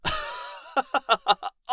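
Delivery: high, loud scornful cackle; amused dragon cackle; mocking laugh. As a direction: laugh down at the tiny human. Dragon mocks the adventurer's request.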